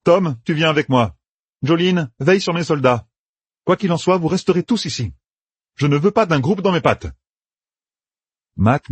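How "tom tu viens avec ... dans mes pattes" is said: put-on raised, higher young soldier giving orders; Matt's voice. A narrator's reading. Matt sends Tom and Talmanes off, commanding. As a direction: bark out orders to his companions.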